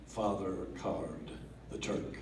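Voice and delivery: ordinary delivery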